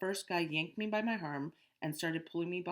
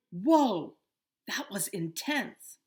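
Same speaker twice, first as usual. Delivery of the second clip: loud, high, slow exclamation; a kid thrilled after escaping bullies; excited relief; 4 semitones higher, 10 dB louder, much slower than usual. Acted. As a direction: let out the rush of adrenaline.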